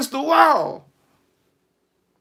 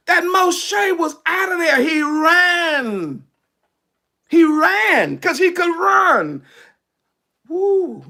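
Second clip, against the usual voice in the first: preaching at full force; 6 semitones higher, slower than usual. Real, not acted. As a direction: preach it up to a shout. Preacher building up.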